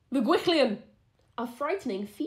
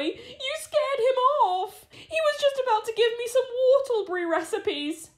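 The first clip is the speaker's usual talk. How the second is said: put-on whining boy; 13 semitones higher, 5 dB louder than usual. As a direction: sulk like a disappointed child. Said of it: "whined the boy".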